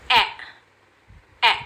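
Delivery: ए with the mouth a little open, between ए and ऐ; phonics e sound. Shown as a demonstration. Teacher modelling the short e, mouth half open. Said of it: "थोड़ा सा मुंह खोल के हम बोलते हैं".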